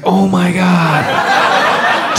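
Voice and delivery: very slow dread-filled groan; hearing about the conference call, dread. Retold or imitated. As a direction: drag it out in mock despair. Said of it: "I'm just like".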